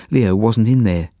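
plain reading voice

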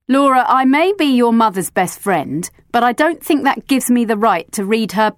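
stern, cutting sarcasm; a cross adult scolding with irony; sarcastic friend scolding the girl; severe and sarcastic. A narrator's reading. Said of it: "very sarcastic when she's cross".

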